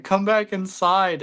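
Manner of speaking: mock childlike pleading wail, pitched high; crying kid begging, acted out; retold crying. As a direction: plead in a high, drawn-out imitation cry. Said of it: "I'm crying".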